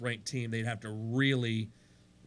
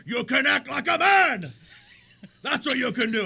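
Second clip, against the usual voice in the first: Godfather impression; 10 semitones higher, 8 dB louder than usual. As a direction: bark it like a mafia boss slapping a weak singer. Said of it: "Godfather gets up and slaps him and goes".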